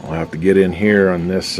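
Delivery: ordinary talk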